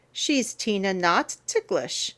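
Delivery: usual speaking voice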